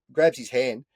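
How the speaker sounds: ordinary talk, nothing marked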